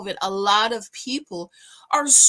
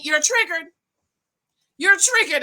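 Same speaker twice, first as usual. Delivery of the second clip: higher, mocking repeat of the taunt; imitating Crystal; 5 semitones higher than usual. Mimicking Crystal taunting Robin with a mock needling voice. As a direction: parrot her needling chant. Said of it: "And Crystal kept saying".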